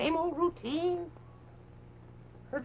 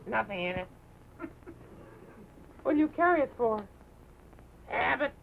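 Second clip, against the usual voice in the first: normal talking voice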